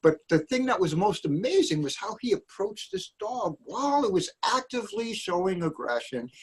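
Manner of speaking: ordinary talk, nothing marked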